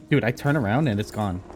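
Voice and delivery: normal talking voice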